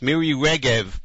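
normal talking voice

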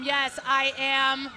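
ordinary delivery

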